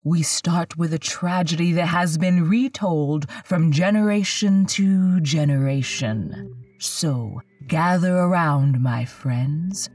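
slow, ominous storyteller intro; horror host. Acted. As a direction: draw them in slow and eerie.

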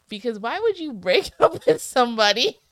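high, loud laughing outrage. Real, not acted. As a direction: laugh through the disbelief. Outraged laughing over book plot.

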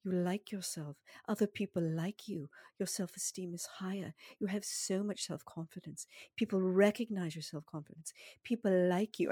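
imitated accented meditation recording, evenly measured; possibly South African accented guide voice; hypnotist's foreign accent. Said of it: "and she has an accent".

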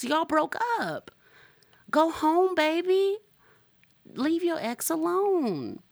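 sing-song sass at the ex; sassy scolding. Acted.

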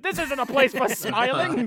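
loud, high-pitched mock outburst; mock scolding. Imitating Larry Miller smacking a child's book away, mock scolding. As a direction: bellow it as a comic scolding, near laughter. Mimics scolding film character.

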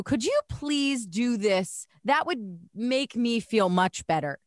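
very deliberate, word-by-word delivery; slow lesson in asking nicely; slow, pointed request. Shown as a demonstration. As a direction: spell it out as for someone not listening. Slow pro tip spelling out the request.

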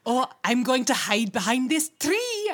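loud, lightly voiced playful dad; playful Jamie. Host imitating Jamie hiding from the baby. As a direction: play hide and seek with a toddler. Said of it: "where he's like".

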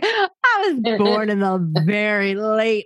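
slow, drawn-out teasing mimicry; mocking imitation. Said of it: "You're like".

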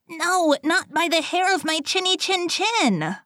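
very high-pitched piglet voice; defiant little pig. A narrator's reading. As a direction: squeal the refusal as a tiny pig. Second pig refuses the wolf.

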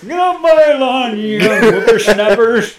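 put-on high, slow cranky old man; old prospector. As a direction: croak it like a grumpy old timer. Grumpy old man parody.

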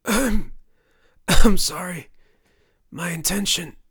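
high, strained rasp in broken bursts; coughing, dying. A narrator's reading. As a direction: choke out an apology between coughs. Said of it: "Jack coughed".

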